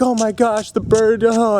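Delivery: high, delighted greeting to a pet; retold overjoyed fussing; happy gushing. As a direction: gush over your bird, pitch lifted. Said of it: "And I was so happy".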